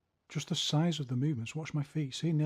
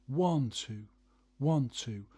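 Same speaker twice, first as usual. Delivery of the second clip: one punched louder, two lighter; stress on one; 5 dB louder, much slower than usual. Shown as a demonstration. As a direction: hit the word One hard. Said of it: "more emphasis on the jab".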